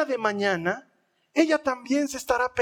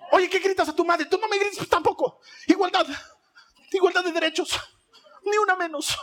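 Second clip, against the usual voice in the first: put-on much higher, sassy teen with a laugh; mouthy daughter; 9 semitones higher than usual. Sassy daughter answering her mother back, high and mocking. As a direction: talk back like a defiant preteen. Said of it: "Te dice".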